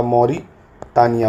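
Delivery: drawn-out Mauritania, every syllable slow; teacher slowly models Mauritania for the class; slow drill. Shown as a demonstration. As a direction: stretch Mauritania out slowly for learners. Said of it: "teacher drills the country name".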